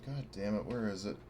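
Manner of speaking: normal talking voice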